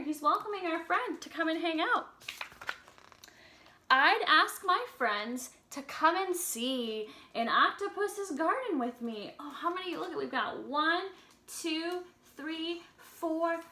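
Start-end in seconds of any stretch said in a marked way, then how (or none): 3.9-9.3 slow, sing-song storybook reading; reading to children. Acted. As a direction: read the lyrics gently to little kids. Reads song lyrics to children.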